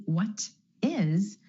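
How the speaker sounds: "what is" with a released T before "is"; true T. Shown as a demonstration. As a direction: say "what is" with a crisp T between the words. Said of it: "Instead of a true T".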